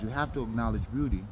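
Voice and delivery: normal talking voice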